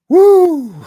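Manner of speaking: high, loud whoop. Real, not acted. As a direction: shout a happy woo. Whoops while laughing.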